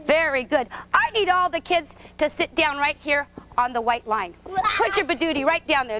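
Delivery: ordinary talk, nothing marked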